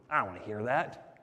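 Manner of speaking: low, soft reluctant mutter; reluctant grumble. Acted. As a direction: mumble it low, as an unwilling listener. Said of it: "you're gonna go".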